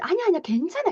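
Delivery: high, hasty mock soothing; dismissive reassurance. Parent playing down a child's feelings, dismissive reassurance. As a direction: brush the worry away. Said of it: "그러니까 별것 아닌 거죠".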